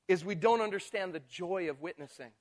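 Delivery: normal talking voice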